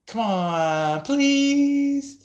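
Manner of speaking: high, whiny pleading; mock begging. Pestering person begging for private details, high and whiny. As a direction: beg like a pestering kid.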